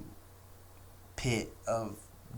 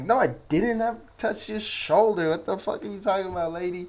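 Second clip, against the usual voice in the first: husband protesting; 9 semitones higher, 7 dB louder than usual. Retold or imitated. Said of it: "And he's like".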